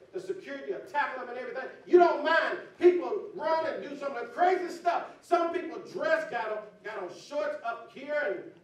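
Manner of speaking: loud, high, fast preach; preaching at full force. Acted. Pastor scolding the congregation about streakers, loud preaching. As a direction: hit them with a shouted sermon.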